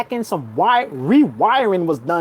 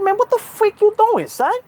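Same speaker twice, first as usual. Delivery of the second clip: retold confrontation; 12 semitones higher than usual. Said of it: "I'm looking at him like".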